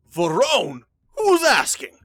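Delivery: loud, high barked challenge from behind the door; gruff demand. Acted. Vampire lord shouting at his servant through the door. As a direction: snap it out as an annoyed lord. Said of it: "you hear this voice go".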